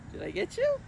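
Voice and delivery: very high playful tease; teasing. Acted. Miss Julie teasing the kids she tricked, high and playful. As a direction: catch them out gleefully.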